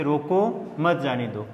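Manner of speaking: slow "रोको", a break, then "मत जाने दो" as one group; pause after रोको. Shown as a demonstration. Teacher showing the changed meaning, slowly, with a break after "रोको". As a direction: split the phrase after "रोको". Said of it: "तो इसका अर्थ परिवर्तन हो गया".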